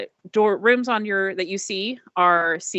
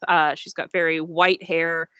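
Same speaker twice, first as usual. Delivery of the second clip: normal talking voice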